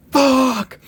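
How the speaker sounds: sudden high loud curse; alarmed shout. Acted. Harry hearing the girls scream, alarmed shout. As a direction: yell it as the scream rings out.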